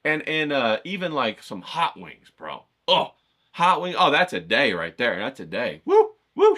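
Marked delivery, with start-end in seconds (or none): 5.9-6.5 high, loud whooping cheer; excited whoop. Real, not acted. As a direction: whoop like the party just started. Hyped about shuffleboard and wings.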